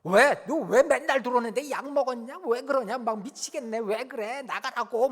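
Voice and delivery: imitating a child screaming at a parent; angry yelling kid. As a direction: throw the parent out in a fit. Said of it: "막 소리를 지른다고요".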